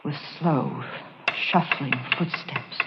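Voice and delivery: hushed dread. A narrator's reading. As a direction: draw it out as the footsteps come closer. Narrator tells creeping dread.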